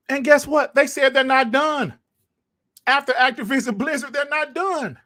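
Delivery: high, insistent, astonished delivery; excited hype. Real, not acted. Podcast host hyping that Xbox is not done buying, excited hype. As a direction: pitch it way up in giddy disbelief.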